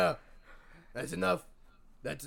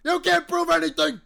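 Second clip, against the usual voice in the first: loud, higher defensive denial; defensive shout; 8 semitones higher, 5 dB louder than usual. Acted. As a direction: shout down the accusation. Dad denies it hotly.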